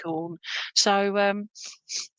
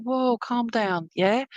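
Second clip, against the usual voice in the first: soothing calm down; much slower than usual. Retold or imitated. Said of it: "it's like".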